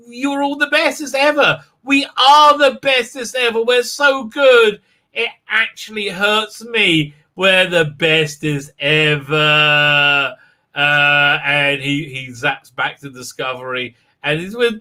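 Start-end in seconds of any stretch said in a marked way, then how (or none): 0.1-10.3 high, slow gushing praise; mock crew gushing. Mocking the whole crew praising each other, high slow gush. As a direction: drag it out as a sugary parody. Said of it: "everybody says".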